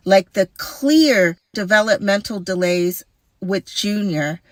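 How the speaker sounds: usual speaking voice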